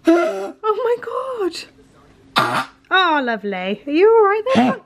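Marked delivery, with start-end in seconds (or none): 0.1-1.5 slow, higher, drawn-out cry of dismay. Real, not acted. Owner watching her dog retch, slow dismayed cry. Pet heaving in background. 3.8-4.8 higher, louder coo at the dog; concerned cooing. Real, not acted. Owner checking on her heaving dog, higher concerned coo.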